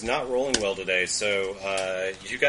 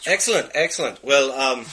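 higher, louder Scottish approval; Scottish accent; 4 semitones higher, 7 dB louder than usual. Acted. Cousin pleased the party agrees, loud higher Scottish accent. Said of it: "I lost my accent there".

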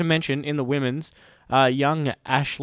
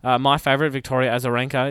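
ordinary talk, nothing marked

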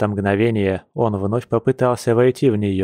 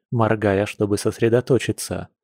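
ordinary delivery, nothing marked

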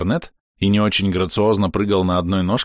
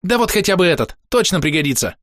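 high, eager little voice; chirping cricket, insistent; 10 semitones higher than usual. A narrator's reading. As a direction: advise briskly in a cricket voice.

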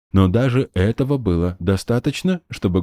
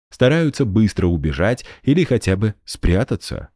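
usual speaking voice

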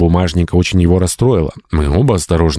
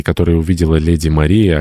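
ordinary delivery

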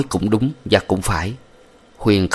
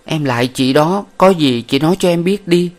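put-on higher pleading young woman; 8 semitones higher than usual. A narrator's reading. Huyen begging Chi Bay Ot for the truth, pleading. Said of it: "Nàng van lại chị ta".

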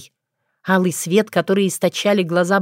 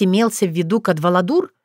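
plain reading voice

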